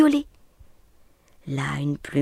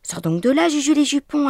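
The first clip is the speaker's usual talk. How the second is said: louder, rising plea of Sors donc de là; pleading big brother, higher and louder; 8 semitones higher, 7 dB louder, faster than usual. A narrator's reading. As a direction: beg him to come out. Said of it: "implorait son grand frère".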